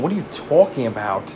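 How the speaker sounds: usual speaking voice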